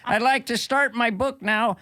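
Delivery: lightly voiced Peterson accent, laughing through it; a weak stab at Peterson's Canadian accent; bad Jordan Peterson accent. Comic imitating Jordan Peterson plugging his book, bad Canadian accent. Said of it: "It's not my best accent".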